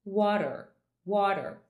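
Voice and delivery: American water with a rolled-back R at the end; water in an American accent, ending in a retroflex R. Shown as a demonstration. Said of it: "eu falaria".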